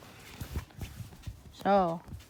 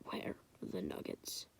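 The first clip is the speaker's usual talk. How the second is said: whisper; 18 dB quieter than usual. Acted. Asking quietly for the nuggets.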